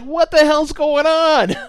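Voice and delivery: high, loud, slow shocked cry; shocked disbelief. Retold or imitated. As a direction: squeal your own alarm at the scene. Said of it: "And I'm like".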